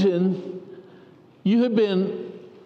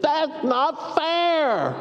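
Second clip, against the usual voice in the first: slow, high, drawn-out whine; whining; 9 semitones higher, much slower than usual. Acted. Lame man whining to Jesus, slow and high. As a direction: stretch it out like a sulky child. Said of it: "when we're whining".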